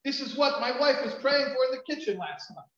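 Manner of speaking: high, loud astonished realization; amazed outburst, retold; retold shock. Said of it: "He was totally shocked".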